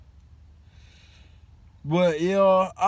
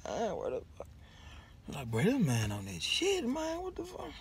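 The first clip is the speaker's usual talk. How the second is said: much softer, disbelieving mutter; muttered under breath; 4 semitones higher, 13 dB quieter than usual. Retold or imitated. Student in class muttering about the strange professor, much softer. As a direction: mutter it so the professor can't hear.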